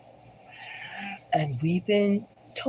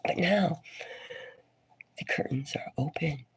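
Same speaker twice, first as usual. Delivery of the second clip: hushed whisper; 2 semitones lower, 6 dB quieter than usual. Real, not acted. Host revealing a watcher above, whispering. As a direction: whisper it as if they might overhear. Dramatic reveal of hidden emperor.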